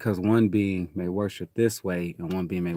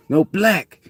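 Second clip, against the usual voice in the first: higher and louder flat refusal; emphatic old rule; 9 semitones higher, 7 dB louder than usual. Retold or imitated. Retelling his younger self refusing to date, higher and louder.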